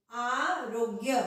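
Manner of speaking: आरोग्य spoken slowly, ग्य joined after रो; drawn-out careful आरोग्य; slow demo. Shown as a demonstration. Teacher slowly models आरोग्य. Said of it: "तो वो कैसे लिखेंगे हम".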